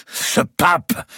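high, loud burst; clenched outburst. A narrator's reading. Louis lashes out about the pope, fists clenched. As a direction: explode on the word. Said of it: "en serrant les poings".

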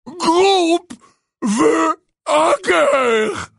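loud, high, slow roar; giant's demanding bellow. Acted. Giant demanding from terrified Hermione, roaring. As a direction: drag out each word in a huge bellow.

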